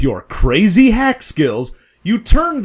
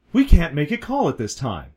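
usual speaking voice

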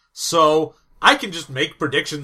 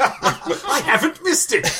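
joyful exclaim; 9 semitones higher than usual. Pretend. Joyful Scrooge bit continued.

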